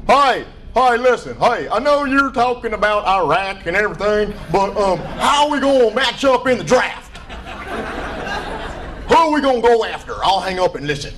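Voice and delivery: hammed-up call-in show caller; eager radio caller. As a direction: blurt it like a sports call-in regular. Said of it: "They will find a telephone and call in".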